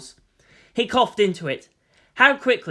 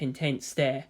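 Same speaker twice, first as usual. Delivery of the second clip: usual speaking voice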